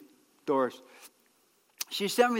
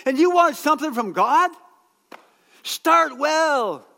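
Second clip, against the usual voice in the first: raised, louder preaching call; loud exhortation; 7 semitones higher, 7 dB louder, slower than usual. Real, not acted. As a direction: press the point home loudly.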